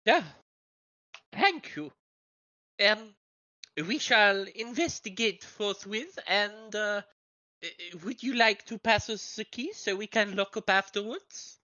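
affected, formal foreign character voice; visiting foreign journalist. Acted. Foreign journalist asking staff for the key, affected and polite. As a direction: put on courtly foreign politeness.

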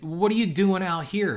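a hint of a blunt, cynical producer; blunt question. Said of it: "and he was real blunt".

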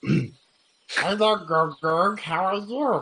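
high squeaky goblin voice. Acted. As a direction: chirp it like a little goblin. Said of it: "Is he a fucking goblin?".

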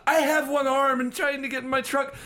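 high-pitched, put-upon victim; exasperated injured man. Acted. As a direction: play a hurt man pleading in exasperation. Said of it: "while he's like".

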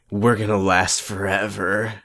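low, soft and slow imitation; mock lover's vow. [name] tells Ashley his vow, slow mock-intense. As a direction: draw out the vow in a hushed put-on voice.

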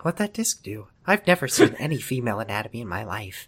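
whisper-soft sleazy charm; hushed mock-seductive college guy; creepy Wes voice. Wes voice continued.

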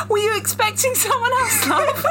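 high, laughing mock British villain; British accent. Briton reading a vampire's line in British accent, laughing. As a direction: ham up a cheesy British charmer, cracking up. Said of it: "I need to do British".

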